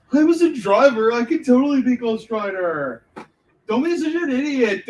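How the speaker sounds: high, loud mock actor voice; Ryan Gosling. Mocking the actor who wants the part, high loud put-on voice. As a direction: put on a silly indignant impression. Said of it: "I don't even think he talks like that".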